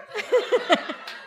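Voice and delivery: very high giggling laugh; incredulous laughter. Real, not acted. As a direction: dissolve into high-pitched laughter. Laughs at the absurd question.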